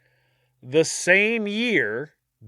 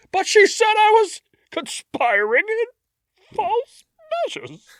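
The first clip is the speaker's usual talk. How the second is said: whiny bailiff; 5 dB louder than usual. Retold or imitated. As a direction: squeal the protest like a wounded official.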